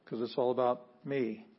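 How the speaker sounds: ordinary talk, nothing marked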